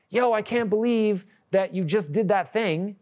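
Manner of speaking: high-pitched mock outrage; indignant gossip. Partner reacting to what you did, mock indignant gossip. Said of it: "what we call talking about the tea".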